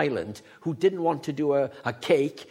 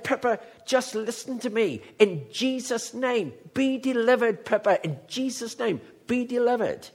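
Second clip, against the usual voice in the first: high-pitched, insistent deliverance prayer; spirit-stirred prayer command; 6 semitones higher than usual. Retold or imitated. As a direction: re-enact commanding someone free in prayer. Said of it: "the Spirit of God rose in me".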